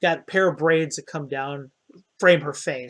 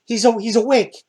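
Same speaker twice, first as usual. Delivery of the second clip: higher, stammered excited voice; excited discovery; 6 semitones higher, 4 dB louder than usual. Acted. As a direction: stammer out the good news in a higher voice. Acted companion sees friend waking.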